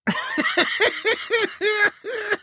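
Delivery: high-pitched run of laughter; bursting out laughing. Acted. Preacher laughing at the horses' shadows, high burst of laughter. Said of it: "burst out laughing".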